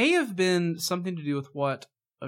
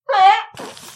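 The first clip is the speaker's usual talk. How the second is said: high squealing laugh; 12 dB louder than usual. Real, not acted. Laughing at own impression.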